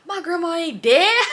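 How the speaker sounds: high, loud, stretched-out crowing; mocking gleeful holler; gleeful reveal. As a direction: crow it slowly, bursting into laughter at the end. Acts out friend gleefully revealing lie.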